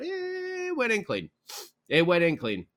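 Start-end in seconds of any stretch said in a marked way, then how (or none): 0.0-1.3 much higher, slow amazed exclamation. Real, not acted. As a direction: let the disbelief lift the line up high. Amazed at Hedman's goal.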